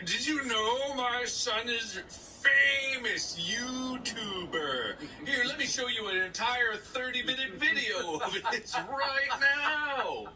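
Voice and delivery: high, slow, overexcited proud mother; bragging mom. Retold or imitated. Mom boasting to guests about YouTuber son, high and gushing.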